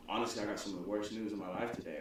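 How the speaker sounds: low, hushed retelling of bad news; grave news, imitated. Friend breaks the worst news of his life, hushed mock-grave. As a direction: drop low and soft, as if confiding.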